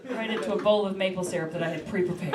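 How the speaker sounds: ordinary talk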